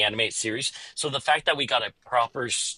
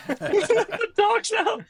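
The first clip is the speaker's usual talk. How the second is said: laughing; much slower than usual. Real, not acted. Laughing at co-host's joke.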